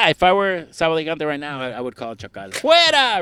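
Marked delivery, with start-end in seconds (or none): 2.5-3.2 loud announcer shout. Retold or imitated. El Chacal catchphrase.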